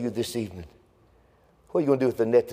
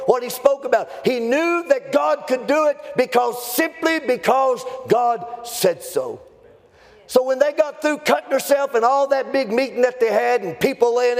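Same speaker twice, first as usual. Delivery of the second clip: high, ringing preacher's shout; full-force preaching; 12 semitones higher than usual. Real, not acted. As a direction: preach it high and forceful, driving every phrase.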